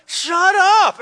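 high, loud, dismissive shout; a retold locker-room yell; shouted retort. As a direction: fire it back at him. Snaps at teammate, throws towel.

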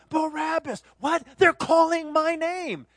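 high, loud startled realization; surprised Barabbas. Pretend. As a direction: hear your own name shouted. Barabbas hears his name.

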